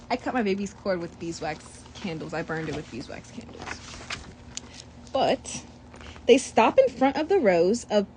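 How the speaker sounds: usual speaking voice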